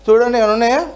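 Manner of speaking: usual speaking voice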